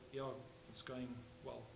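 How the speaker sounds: a hint of a tired soul pretending all is well; weary reply. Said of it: "hear the weight of the soul in the tone".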